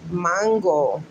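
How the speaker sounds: mango as MAHN-go, Spanish accent; Spanish pronunciation. Shown as a demonstration. Showing how she grew up saying it, Spanish accent. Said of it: "That's how I grew up pronouncing it".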